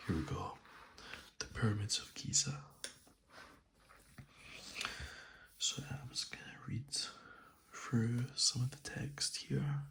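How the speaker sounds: soft ASMR whisper; soothing spa whisper. Real, not acted. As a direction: whisper softly and close to the mic. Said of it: "I'm gonna do this in a soft spoken voice, as this is an A S M R video".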